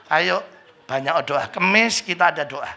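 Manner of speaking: ordinary talk, nothing marked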